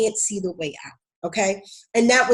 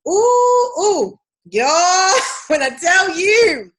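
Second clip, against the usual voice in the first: excited exclamation; 9 semitones higher, 6 dB louder, much slower than usual. Real, not acted. Reacting to hard truth.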